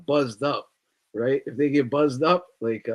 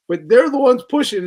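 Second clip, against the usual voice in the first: usual speaking voice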